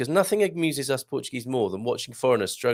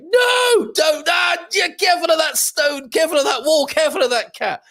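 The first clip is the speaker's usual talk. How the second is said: very high, loud panicked yelling, imitating car sensors; hammed-up high-pitched screamed alarm; mock screaming car; 5 dB louder than usual. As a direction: screech frantic warnings as the car. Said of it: "the technology on the car was screaming out".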